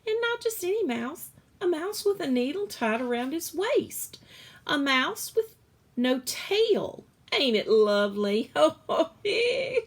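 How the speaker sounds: high, loud, laughing delight; laughing cook. Acted. Cook shaking with laughter, high loud delight. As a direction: laugh through it with glee. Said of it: "her stomach was shaking".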